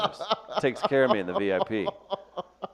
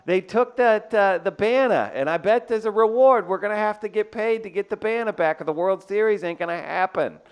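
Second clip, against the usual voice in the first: put-on higher and louder Boston sports fan accent; 9 semitones higher, 5 dB louder than usual. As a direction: rant like a Boston fan at the bar. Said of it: "you do your Boston accent".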